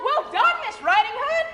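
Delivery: high pig voice, mild mock yell; cheering piglet. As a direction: cheer through the window, high. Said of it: "stood and yelled".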